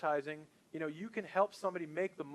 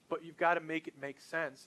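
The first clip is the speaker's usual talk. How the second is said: ordinary delivery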